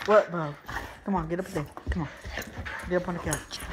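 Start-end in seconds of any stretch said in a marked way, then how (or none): none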